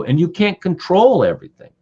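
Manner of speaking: normal talking voice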